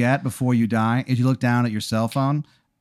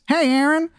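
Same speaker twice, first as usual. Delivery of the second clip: cheery greeting. Retold or imitated. Michael Park greets cheerily.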